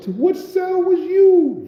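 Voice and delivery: high, slow, drawn-out call; calling guard voice. Retold or imitated. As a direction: stretch the question up high like a guard calling down the tier.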